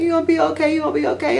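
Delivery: mock soothing. Bag boy comforting a crying customer, mock soothing. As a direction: imitate someone gently reassuring a crier.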